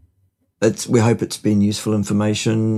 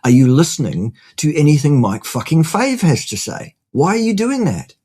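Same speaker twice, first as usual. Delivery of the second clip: exasperated rant; 5 semitones higher than usual. Retold or imitated. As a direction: blow up at the questioner in disbelief. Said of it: "what I also desperately wanna say".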